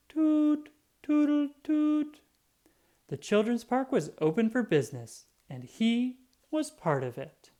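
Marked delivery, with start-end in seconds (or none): 0.1-2.2 very high loud drawn-out toots; long horn toot. A narrator's reading. Little Yellow celebrates the park opening with high long toots. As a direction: sing out a long happy horn. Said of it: "gave an extra long toot".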